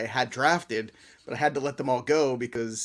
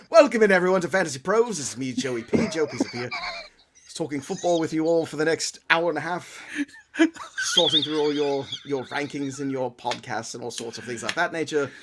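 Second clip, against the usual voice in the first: mock English accent. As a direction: show host intro in a put-on English accent. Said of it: "do the whole show in an accent".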